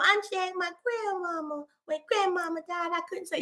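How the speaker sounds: put-on high, soft, whimpery little kid; sad child voice. Sadness grieving grandmama, sad child voice. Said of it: "a sadness".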